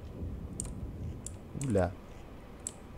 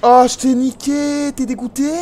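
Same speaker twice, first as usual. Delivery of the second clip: gloating taunt; 12 semitones higher, 9 dB louder than usual. Real, not acted. Streamer taunts the enemy he just killed, loud gloat. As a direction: rub the win in his face.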